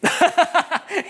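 high hammed-up cackle; a playful villain laugh at the kids; teasing high laugh; mock evil laugh. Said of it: "then I laugh like that".

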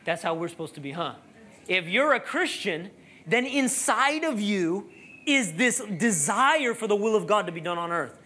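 usual speaking voice

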